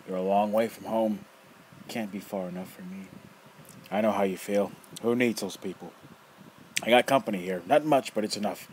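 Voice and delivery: loud, rough, mocking old man; teasing old rogue. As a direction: toy with the kid, loud and gleeful. Nefer voice.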